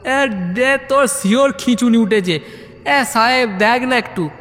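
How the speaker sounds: high, anxious calling; alarmed friend. Acted. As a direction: call for help in mock alarm. Friend sure he is having fits. (আতঙ্কিত বন্ধু ডাকছে)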